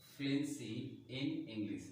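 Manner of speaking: "fluency in English" stretched out very slowly, word by word; slow dictation. Shown as a demonstration. Teacher showing students the phrase, slow and deliberate. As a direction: draw out "fluency in English" as if writing it down. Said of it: "मैंने अलग अलग word pronounce किया".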